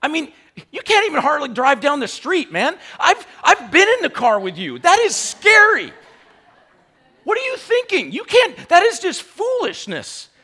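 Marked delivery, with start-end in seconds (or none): 4.8-10.3 higher, laughing mock alarm; joking mock scolding; playful teasing. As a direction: tease a friend in church. Teasing congregation member.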